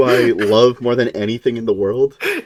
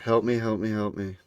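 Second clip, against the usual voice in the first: fading, much softer helpless plea; hammed-up dying whimper, much softer; mock feeble plea; 4 semitones lower, 12 dB quieter than usual. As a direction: let it die away weakly. Mock victim pleading.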